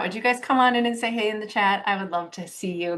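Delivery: ordinary delivery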